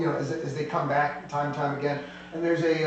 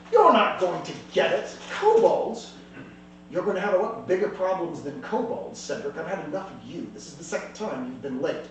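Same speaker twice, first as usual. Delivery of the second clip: cold scorn. Acted. Dragon lady dismisses Cedric.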